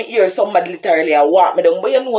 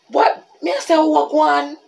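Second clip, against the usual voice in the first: higher, startled what; jolted, demanding to know; shocked; 10 semitones higher than usual. Retold or imitated. Grabbed from behind by a man, startled.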